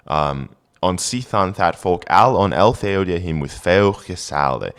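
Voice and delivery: put-on careful Old English recitation. Shown as a demonstration. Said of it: "reading Old English text aloud".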